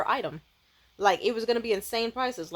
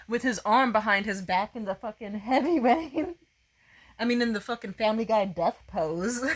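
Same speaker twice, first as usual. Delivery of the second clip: usual speaking voice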